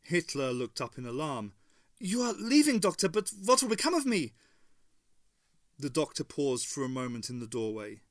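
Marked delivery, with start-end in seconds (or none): 2.0-4.3 much higher, louder alarmed question; high anxious plea. A narrator's reading. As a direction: cry out in alarm at being left. Hitler in alarm.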